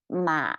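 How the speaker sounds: "Ma" dropped to a low pitch; third tone. Shown as a demonstration. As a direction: sink low on a drawn-out "Ma". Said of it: "Third tone means horse".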